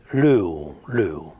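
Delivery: l'U tenuta a lungo e più bassa; U prolungata. Shown as a demonstration. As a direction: allunga la U di l'U. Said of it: "la pronuncia è una U prolungata". (U lunga piemontese)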